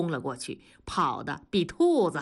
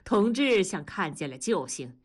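ordinary delivery

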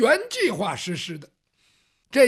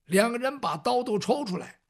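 ordinary delivery, nothing marked

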